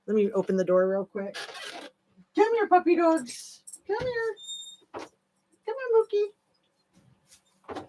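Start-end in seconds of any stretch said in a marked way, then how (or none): 2.4-7.8 much higher, sing-song pet voice calling the dogs; calling puppies. Real, not acted. Owner calling her puppy dogs back inside, high sing-song. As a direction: coax the dogs in, sweet and drawn out.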